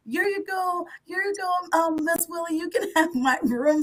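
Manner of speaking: hint of a sweet teenager offering her room; the daughter giving up her room to a guest, lightly voiced; voicing the teen daughter. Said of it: "her daughter was like".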